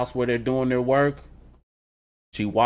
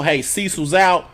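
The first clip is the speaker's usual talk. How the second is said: usual speaking voice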